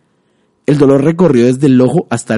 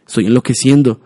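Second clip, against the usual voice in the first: frantic pleading, high and loud; desperate prayer; 4 semitones higher, 5 dB louder than usual. Acted. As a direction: beg God, breaking down. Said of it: "rezó apenas audiblemente". (rezo desesperado)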